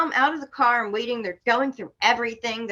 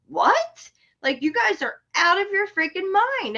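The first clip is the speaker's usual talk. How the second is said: high-pitched, disbelieving outburst; retold shock, pitch leaping up; incredulous; 10 semitones higher than usual. Driver to police accusing her of guns and drugs, incredulous.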